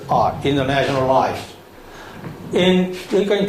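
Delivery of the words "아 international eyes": ordinary delivery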